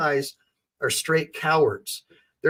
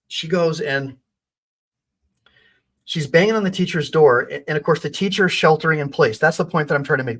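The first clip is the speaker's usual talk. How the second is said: normal talking voice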